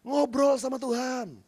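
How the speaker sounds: slow, softened heart-to-heart invitation; hushed sincere plea; tender appeal. Real, not acted. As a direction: draw them in gently. Drops into intimate appeal.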